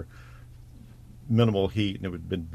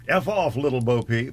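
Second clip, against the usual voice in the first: higher retold street heckle; mock yell; 5 semitones higher, 4 dB louder than usual. Host quoting the clod who heckled her in London, mock yell. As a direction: call it out as a jeering passer-by. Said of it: "some clod yelled".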